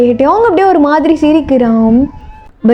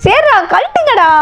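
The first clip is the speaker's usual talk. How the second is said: exasperated giving in; 10 semitones higher than usual. Acted. Friend telling his pals to take it off, exasperated. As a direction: drag out a high, resigned plea.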